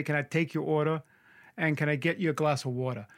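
New York accent, dropping final r in "order" and "water". Shown as a demonstration. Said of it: "but sometimes I wanna say".

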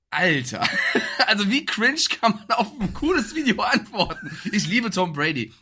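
laughing through the words; cracking up in disbelief. Real, not acted. Laughing at Brady's reply.

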